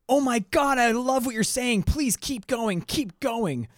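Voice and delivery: high, eager, fawning praise; gushing fan. Retold or imitated. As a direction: gush like a starstruck disciple. Trismegistus gushing at Pomander.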